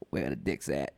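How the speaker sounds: very low, soft put-on voice; low, quiet rapper impression. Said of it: "he's just like".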